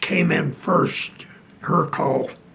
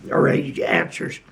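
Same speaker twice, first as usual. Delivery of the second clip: ordinary talk, nothing marked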